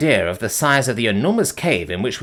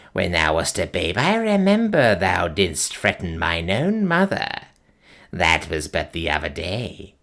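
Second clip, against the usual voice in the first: low, slow, eerie crone voice; piping old witch; 4 semitones lower, slower than usual. Acted. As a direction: croak it out like an ancient witch. Gagool mocks Invadus.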